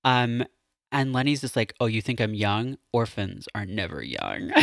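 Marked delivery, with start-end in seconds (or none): 1.8-4.5 low, softer dramatic line; a touch of brooding Pope. Retold or imitated. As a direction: drop it low and ominous. Lenny's brooding reply.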